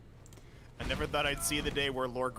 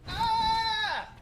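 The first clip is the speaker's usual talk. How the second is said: imitated scream; 4 dB louder than usual. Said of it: "the moment I stopped hearing, outside of here".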